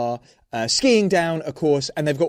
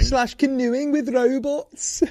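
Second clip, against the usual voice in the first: high, slow mock Scouse with a laugh; badly done Liverpudlian host; laughing Scouse take on "canoeing with robots"; bad Liverpool accent; 9 semitones higher, slower than usual. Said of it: "I'm so bad at this accent".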